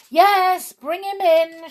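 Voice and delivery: high, loud shout back. A narrator's reading. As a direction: call back at full pitch. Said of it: "shouted Patter".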